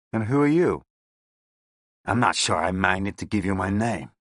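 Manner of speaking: lightly American, guarded; New York accent. A narrator's reading. Gang boss refusing his name to Pinkerton, New York accent. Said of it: "he too had come from New York".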